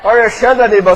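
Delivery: loud, slow broadcaster introduction; announcer call. Shown as a demonstration. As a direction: boom the name like a radio host. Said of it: "eu vou treinar chamando vocês em casa".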